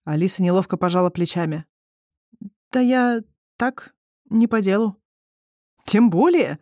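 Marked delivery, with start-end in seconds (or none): 2.7-5.0 slow, drawn-out, higher-pitched evasive answer; awkward hesitation. A narrator's reading. Alisa dodges her friend Liza's questions, awkward and hesitant. Алиса неловко мнётся перед Лизой. 5.9-6.6 emphatic exclamation, pitch jumping up; exclaiming. A narrator's reading. Friend presses awkward Alisa in public, exclaiming. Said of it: "воскликнула Лиза".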